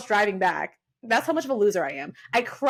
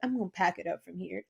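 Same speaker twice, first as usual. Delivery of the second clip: quiet retold sulk; 4 semitones lower, 8 dB quieter than usual. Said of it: "I'm like".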